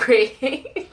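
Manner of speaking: ordinary talk, nothing marked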